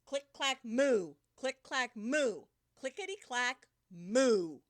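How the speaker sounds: booming click clack and moo; loud sound effects. Shown as a demonstration. Reading the cows' typing to kids, loud and booming. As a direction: belt out the barn noises. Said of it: "supposed to say it really, like, really loud".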